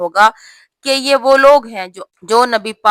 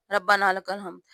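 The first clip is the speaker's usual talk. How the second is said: softer quick mock of ربنا لک الحمد; hurried wrong way; 2 semitones lower, 7 dB quieter than usual. Shown as a demonstration. Teacher imitating the rushed wrong recitation, softer and quick. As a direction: rush ربنا لک الحمد as a careless habit. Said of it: "ہم جلدی جلدی میں ایسے پڑھتے ہیں".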